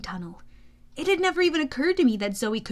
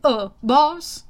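hesitant, louder sidekick interjection; uneasy horse sidekick; nervous; 2 semitones higher, 8 dB louder than usual. Acted. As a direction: timidly get the boss's attention. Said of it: "Blackjack said nervously".

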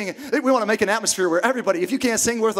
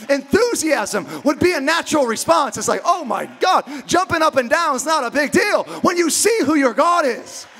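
full-force preaching; 6 semitones higher than usual. Acted. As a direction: build the praise into a shout.

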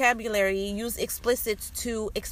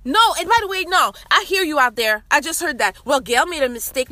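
raised, louder voice with lively pitch; calling out an objector; 3 semitones higher, 6 dB louder than usual. Real, not acted. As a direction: call out the doubter in the audience, loudly. Calls out an imagined objecting listener.

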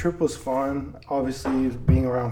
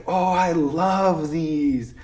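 lightly voiced little kid, slow and higher; gushing little kid; 4 semitones higher, 5 dB louder, much slower than usual. Little cousin spotting Sour Patch Kids, lightly imitated gushing voice. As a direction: draw out LOVE like a delighted child. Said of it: "you would just have to hear his voice".

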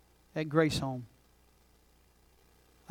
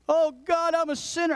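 crying out; 9 semitones higher than usual. Retold or imitated. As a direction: cry out in repentance. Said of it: "she just cried out to God".

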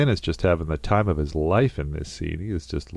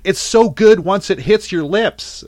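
much higher, louder, slow blissful movie quote; Will Ferrell quote; 9 dB louder, slower than usual. Retold or imitated. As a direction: savour it, high and loud like a party legend reborn. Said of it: "he's got this great quote".

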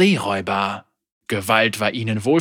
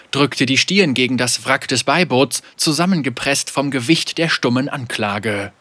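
ordinary delivery, nothing marked

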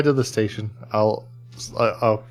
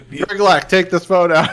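louder command cracking with laughter; laughing order; 7 semitones higher, 6 dB louder than usual. Acted. Player ordering Griglak to rip out the phone, loud and laughing. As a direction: bark an order at the henchman while laughing.

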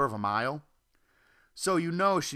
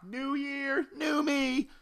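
much higher, bright-eyed cheer; mock enthusiasm; much slower than usual. Announcing his new-year resolve, much higher mock cheer. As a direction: sell the cliché with fake glee.

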